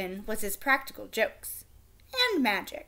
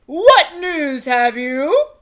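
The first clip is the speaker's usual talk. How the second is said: impatient king; 3 semitones higher, 12 dB louder, much slower than usual. A narrator's reading. King answering the noisy crier, loud and slow. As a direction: wave the crier on, tired of the fuss. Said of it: "said the king".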